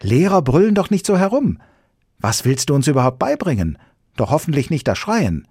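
a schoolgirl shaking her head; little girl disapproving. Acted. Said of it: "sagte das kleine Mädchen".

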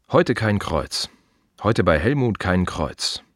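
ordinary talk, nothing marked

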